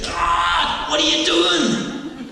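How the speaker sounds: loud, rising mock wail to God, laughing; hammed-up desperate cry; mock scream at God. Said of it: "you scream and you say".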